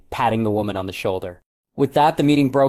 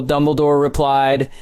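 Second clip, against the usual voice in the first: usual speaking voice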